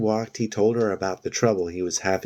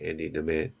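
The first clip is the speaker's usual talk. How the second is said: ordinary delivery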